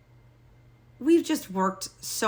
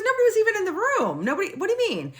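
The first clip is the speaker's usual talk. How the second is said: higher, quick puzzled denial; lightly voiced husband, baffled; 12 semitones higher, faster than usual. Husband denying anyone was there, high and quick. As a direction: play the confused husband.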